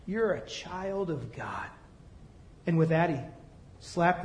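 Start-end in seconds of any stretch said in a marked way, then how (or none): 0.1-1.7 soft and slow, gently assuring; tender. Retold or imitated. Preacher gently telling the boy whose child he is. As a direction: tell him softly he belongs.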